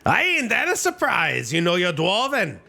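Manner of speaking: hammed-up Scottish brogue, delighted; Scottish accent. Dwarf shopkeeper hears Travis speak Dwarven, Scottish accent. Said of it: "the classic Scottish accent".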